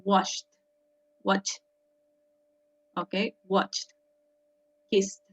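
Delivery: "Washed, watched, kissed" each closed with a T sound. Shown as a demonstration. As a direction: model the final T on "Washed, watched, kissed", one word at a time. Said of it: "como una T al final".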